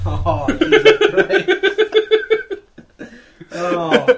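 high, loud laughing exclamation. Real, not acted. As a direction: crack up mid-word. Laughing at crude joke.

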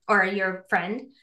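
normal talking voice

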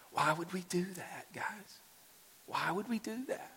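high, soft, near-whispered question; hushed disbelief. Real, not acted. Pastor questioning his congregation, hushed disbelief.

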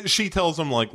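ordinary talk

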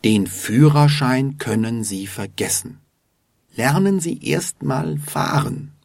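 ordinary talk

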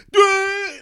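sudden high loud yelp; startled yelp. Real, not acted. Dad jabbed by a toy needle, yelping. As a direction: jolt up with a cry. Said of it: "like, uncontrollably".

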